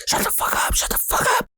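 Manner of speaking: harsh urgent whisper; whispered order to shut up; big brother's hushing. Retold or imitated. Big brother silences the panicking kid, fierce whisper. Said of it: "he's just being big brother right here".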